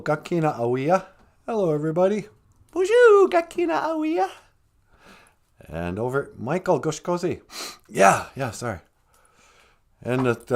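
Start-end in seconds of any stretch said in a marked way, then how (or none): 2.7-4.3 much higher, louder puppet voice; high puppet greeting. Pretend. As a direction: greet everyone as a squeaky puppet.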